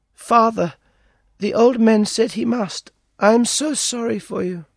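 put-on high girlish voice, sorry and sad; a young girl telling him gently she's sorry; girl's voice. A narrator's reading. Girl pitying the prisoner, high girlish voice. Dina explains and pities him.

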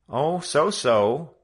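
a coy, noncommittal shrug in the voice; evasive reply. A narrator's reading. Joe answers evasively.

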